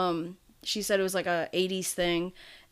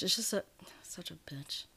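under her breath; 9 dB quieter than usual. Real, not acted. Grumbling aside about the garage.

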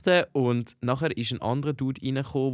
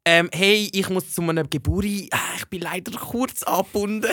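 higher-pitched, hedging mock apology; awkward excuse; 7 semitones higher than usual. Excusing herself to the station woman, awkward excuse. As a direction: wriggle out of it politely.

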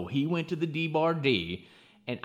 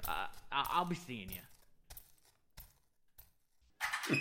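softer, stumbling, nervous goodbye; flustered stammer; 4 semitones lower, 12 dB quieter than usual. Acted. Cowboy stammering a quick exit from the sheriff. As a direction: trip over the words, eager to slip away. Jake flees Greenslade's daughter.

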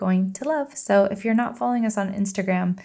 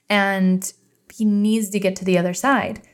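ordinary delivery

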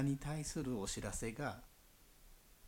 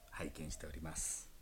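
whispering; 6 semitones lower, 8 dB quieter than usual. Real, not acted. Whispered aside.

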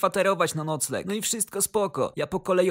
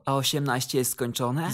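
low, wary question; suspicious; 5 semitones lower than usual. A narrator's reading. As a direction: question his age with suspicion, pitched down. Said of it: "zapytałem podejrzliwie". (podejrzliwie, niskim głosem)

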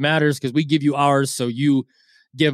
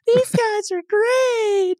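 very high, slow, sugary fan voice; gushing fan; much slower than usual. Retold or imitated. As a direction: swoon like a suddenly loyal fan. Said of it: "changing your one star".

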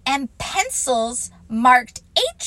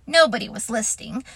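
usual speaking voice

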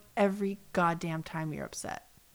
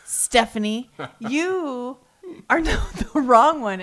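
louder, laughing callout to Stephanie; 4 semitones higher, 9 dB louder, slower than usual. Real, not acted. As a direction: call her out through a laugh. Addressing show character loudly.

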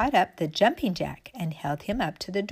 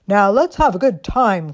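loud, bright toy soldier call; stiff barking toy soldier; 10 dB louder than usual. Acted. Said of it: "cried the tin soldier".

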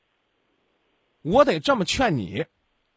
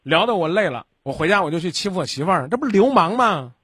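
ordinary talk

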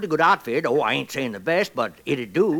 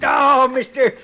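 delighted; 7 semitones higher, 7 dB louder, slower than usual. Acted. Old prospector gets his stake.

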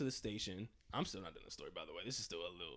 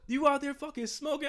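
angry mom; 11 semitones higher, 5 dB louder than usual. Retold or imitated. Mom confronting her son.

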